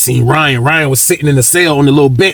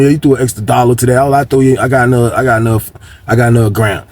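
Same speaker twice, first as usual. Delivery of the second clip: ordinary delivery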